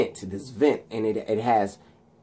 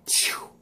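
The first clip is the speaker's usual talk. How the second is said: short airy burst; quick voiceless "pchoo"; light bulb sound effect; 6 dB quieter than usual. Retold or imitated. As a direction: imitate a bulb popping.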